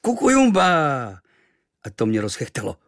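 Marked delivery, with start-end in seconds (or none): 0.0-1.2 slow, higher, loud wild cry; sudden yell. Acted. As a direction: shout it out of nowhere. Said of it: "z ničeho nic zaječel".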